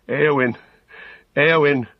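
loud anguished calling of her name; crying out. A narrator's reading. Brother calling his sister's name in grief, loud and anguished. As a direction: call her back through tears. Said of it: "cried Eomer amid his tears".

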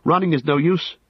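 high, urgent plea; high-pitched, desperate warning; desperate cry. A narrator's reading. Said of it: "he cried desperately".

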